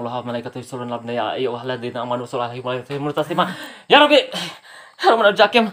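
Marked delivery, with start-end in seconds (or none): 3.9-5.7 loud, high fearful cry to God; sudden loud plea. Real, not acted. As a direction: cry out for protection. Crying out in fear.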